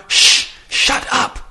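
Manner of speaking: sharp, voiceless shushing; fierce stage whisper, all breath; furious whisper. A narrator's reading. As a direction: silence them in an angry whisper. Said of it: "in a furious whisper".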